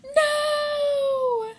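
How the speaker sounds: hammed-up dismay at a story ending; mock anguished cry. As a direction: wail it like your heart just broke. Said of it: "I was like".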